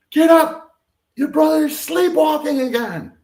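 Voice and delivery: high, loud panicked shout; alarmed scream. Retold or imitated. Mother waking her son about his sleepwalking brother, frantic scream. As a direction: scream it like your child is in danger. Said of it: "my mother screams down to me".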